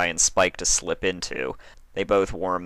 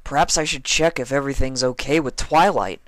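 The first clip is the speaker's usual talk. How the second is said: Spike's voice; 8 semitones higher, 4 dB louder than usual. A narrator's reading. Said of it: "Spike audibly thought to himself".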